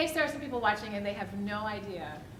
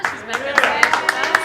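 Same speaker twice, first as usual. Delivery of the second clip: loud stage announcement to the crowd; announcing; 6 semitones higher, 13 dB louder than usual. Real, not acted. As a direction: sell her comeback to the room. Host announces singer's return.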